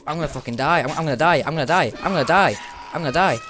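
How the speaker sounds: high, loud panicked cries; frantic, sure he's about to die; panicking gamer. Real, not acted. Out of ammo, two enemies.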